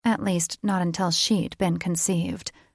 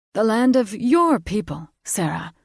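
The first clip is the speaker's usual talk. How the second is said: louder, higher, grandly announcing his lineage; proud fae lord; 3 semitones higher, 4 dB louder than usual. A narrator's reading. Father proudly introducing himself to his daughter, grand and loud. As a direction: puff up and declare it. Brain's voice.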